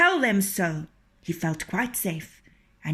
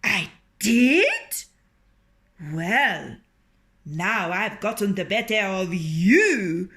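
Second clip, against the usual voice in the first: gloating rooster; 5 dB louder than usual. A narrator's reading.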